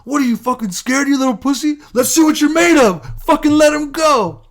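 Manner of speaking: high, loud, goading coach voice; taunting coach. Retold or imitated. Coach daring his scared wrestler, taunting shout. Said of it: "this is my coach. He's like".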